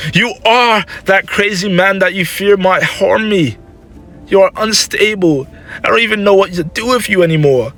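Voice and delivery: high, distraught motherly reproach; upset accusation. Acted. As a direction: accuse your son at the end of your tether. Mother accuses her son.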